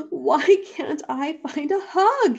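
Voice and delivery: high, plaintive little-bear voice. A narrator's reading. Poor young bear failing to find hugs, plaintive. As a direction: wail it as a forlorn question.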